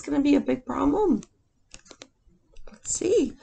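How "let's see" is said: soft unvoiced whisper; hushed storytime whisper. Real, not acted.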